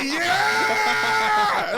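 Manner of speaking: very high, loud cheer; hyped yell. Retold or imitated. Kid hyped by the metal theme song, loud cheer. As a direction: yell it like a fan at the show's intro. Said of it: "I was like".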